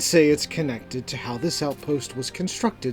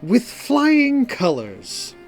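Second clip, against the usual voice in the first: slow, higher, silky reply; Calor, smooth; 9 semitones higher, 4 dB louder, much slower than usual. A narrator's reading. Calor answering Simone about the test, smoothly. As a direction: draw it out smoothly. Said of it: "Calor replies smoothly".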